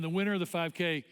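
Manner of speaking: ordinary talk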